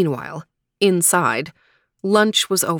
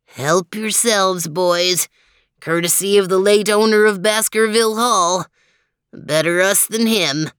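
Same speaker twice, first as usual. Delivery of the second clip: put-on amused old dog; 4 dB louder than usual. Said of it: "laughed Lestrade".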